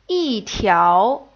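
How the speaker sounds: "yi" falling, "tiao" rising; fourth tone yi. Shown as a demonstration. Teacher shows the changed tone, "yi" falling. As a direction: drop "yi", then swing "tiao" up. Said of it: "read the second column one more time".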